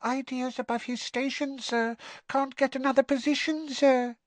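very high squeaky voice, a touch of sorrow; disapproving and sorry for her friend; sad elf squeak. Acted. Winky lamenting Dobby's freedom to Harry, sad squeak. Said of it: "said Winky sadly".

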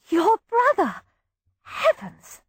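high, delighted gasp of surprise; girlish gasp of delight; joyous surprise. A narrator's reading. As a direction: burst out in happy shock. Girl gasps with joyous surprise.

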